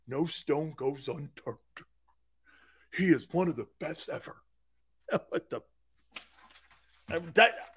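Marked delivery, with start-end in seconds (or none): 0.1-4.4 mock fawning. Shown as a demonstration. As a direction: gush softly, mocking an adoring reporter. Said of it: "There's a way of doing it instead of going".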